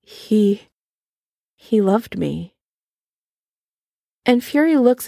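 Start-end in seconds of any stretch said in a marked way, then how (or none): 0.0-2.5 quivering voice. A narrator's reading. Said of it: "His voice quivers".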